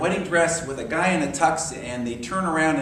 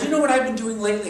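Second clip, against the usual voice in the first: higher, louder, fast teasing question; eager friend; 7 semitones higher, 5 dB louder, faster than usual. Retold or imitated. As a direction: tease out the secret quickly.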